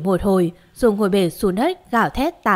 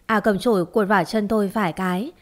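plain reading voice

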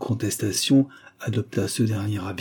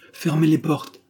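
higher, urgent command; urgent order; 6 semitones higher than usual. A narrator's reading. Marquise orders servant to shut out the count, urgent. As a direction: order it at once, alarmed.

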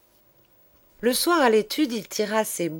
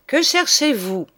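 loud, high teacher's demand; stern teacher questioning the boy; sharp question; 7 semitones higher, 7 dB louder than usual. Acted. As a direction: snap the question at him.

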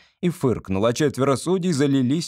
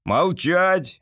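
sharp, higher-pitched command; barked order; 6 semitones higher than usual. A narrator's reading. Presiding judge silencing a colleague, barking. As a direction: bark it to cut the judge off. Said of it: "рявкнул председатель суда".

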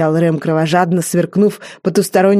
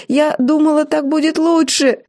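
much higher, shaking voice; the frightened maid excusing herself, high and shaky; trembling fear; 10 semitones higher than usual. A narrator's reading. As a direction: plead while shaking all over. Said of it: "Её колотила крупная дрожь".

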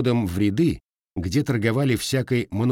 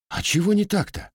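bewildered; 10 semitones higher than usual. A narrator's reading. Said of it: "недоумевать парень".

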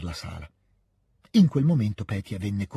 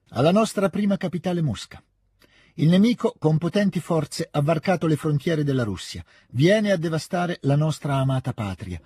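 higher, careful formal declamation; attentive proclamation reading; 6 semitones higher than usual. A narrator's reading. Sonia reads the Emperor's proclamation to the family, attentive formal reading. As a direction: read out a solemn proclamation, pitched higher. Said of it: "leggeva attentamente Sonia".

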